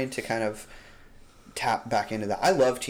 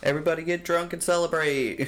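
mock cheering; 6 semitones higher than usual. Mock New Year celebration meme.

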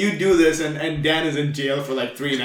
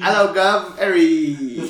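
mock British accent; 2 semitones higher, much slower than usual. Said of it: "my British accent".

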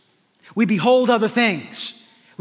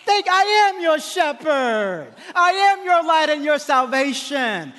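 a hint of God's voice, slow and gentle; lightly voiced God, slow and welcoming; God receiving prayer; 8 semitones higher than usual. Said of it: "God says".

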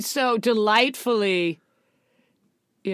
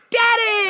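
high, crying shout of DADDY; DADDY shouted high, stretched at the end; emphatic exclaimed question; 12 semitones higher, 4 dB louder than usual. Acted. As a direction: yell DADDY in mock alarm. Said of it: "it's a question said with emphasis".

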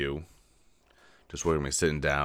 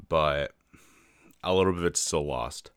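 ordinary talk, nothing marked

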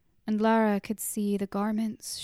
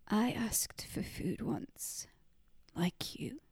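slow, soft whisper; hushed confession; 7 dB quieter than usual. A narrator's reading. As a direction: confess it quietly, ashamed. Girl confessing her ordeal.

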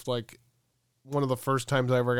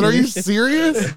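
raised, high-pitched disbelief, acted out; incredulous; 9 semitones higher, 6 dB louder than usual. Storyteller challenging the waiting driver again, loudly incredulous. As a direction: throw it back in mock outrage. Retold argument at car window.

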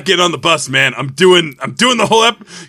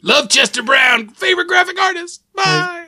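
shouting; 11 semitones higher than usual. Acted. Character's parting shout.

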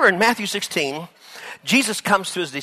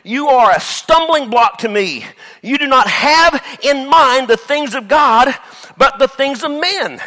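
louder, slower, weighty command; stern rebuke; 6 semitones higher, 5 dB louder than usual. Acted. Jesus rebuking Peter, loud, slow and stern. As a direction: rebuke him slowly and firmly. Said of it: "He says".

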